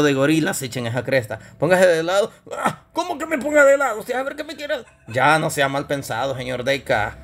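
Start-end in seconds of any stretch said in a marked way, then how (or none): 2.5-4.8 high, startled comic puppet voice; outraged high-pitched little character; indignant puppet character. Acted. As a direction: recoil with suspicion in a high voice. Puppet Deca suspicious of host.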